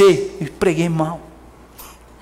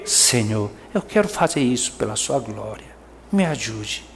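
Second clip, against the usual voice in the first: lower, softer, earnest plea to God; humble prayer; 8 semitones lower, 8 dB quieter than usual. Pretend. Believer praying to God for help, soft and humble. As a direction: pray humbly and softly. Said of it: "alfa, o que que é?".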